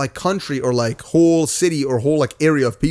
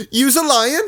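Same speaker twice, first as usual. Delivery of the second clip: high, mock-indignant British accent; mock accusation; 12 semitones higher, slower than usual. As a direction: imitate an outraged accusation, pitched way up. Acts out talk-show argument.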